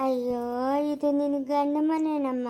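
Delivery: ordinary delivery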